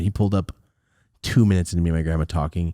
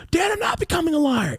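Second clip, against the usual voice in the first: high protest. Retold or imitated. Denying dad's accusation.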